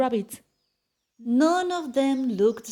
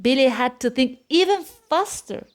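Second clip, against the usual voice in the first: plain reading voice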